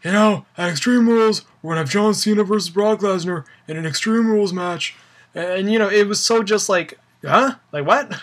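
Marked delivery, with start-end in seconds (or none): 0.0-5.0 lightly voiced stiff announcer, a bit higher; mock official announcement; imitating Laurinaitis. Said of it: "walk into the ring and say". 7.2-8.1 baffled huh. Real, not acted. Mock confusion at the announcement.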